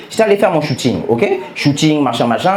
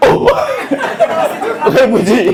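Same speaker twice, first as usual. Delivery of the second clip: higher, drawn-out "Oh, Rebuti"; surprised recognition; slower than usual. Retold or imitated. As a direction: gasp it in disbelief. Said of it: "Il fait".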